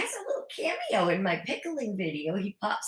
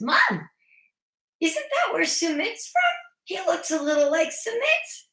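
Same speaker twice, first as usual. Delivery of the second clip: put-on a higher, childlike voice; child voice; 10 semitones higher than usual. Son asking his mom about a student, childlike voice. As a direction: imitate a curious little boy. Little son quoted on Zoom.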